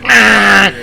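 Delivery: loud high honk-like cry; imitated horn blast. Driver behind blaring the horn, loud imitated honk. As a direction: mimic the angry horn. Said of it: "It was like".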